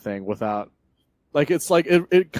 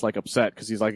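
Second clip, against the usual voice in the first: ordinary talk, nothing marked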